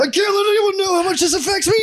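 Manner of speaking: very high strangled wail; mock anguished cry. Worker hiding how much the boss's email hurts, shrieked mock sobbing. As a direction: scream it through fake tears.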